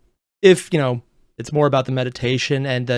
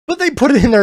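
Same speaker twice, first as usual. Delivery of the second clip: usual speaking voice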